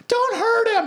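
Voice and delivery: high squeaky pleading voice; mock girl's plea. Girlfriend begs bouncer not to hurt boyfriend, high mock plea.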